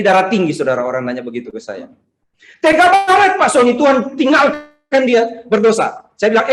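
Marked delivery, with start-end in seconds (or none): 2.6-6.1 high, loud outraged complaint, stretched out; acted-out reproach, pitched up; indignant protest. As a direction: protest in shock at such cruelty. Acting out an outraged questioner's protest.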